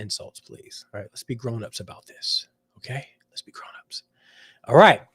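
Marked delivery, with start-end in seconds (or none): 0.0-4.0 soft whispered plea; whispering. Real, not acted. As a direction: whisper it, almost unvoiced. Asking viewers not to insult.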